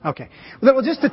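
usual speaking voice

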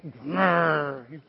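low grumpy growl over a bowl; playful sulky growl; mock growl. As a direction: growl like a sulking old man. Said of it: "I was just like".

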